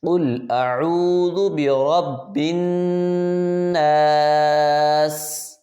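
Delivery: slow drawn-out tajweed chant; Quran recitation. Acted. Teacher reciting for pupils to repeat, slow chant. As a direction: recite each syllable long and even, like in the mosque.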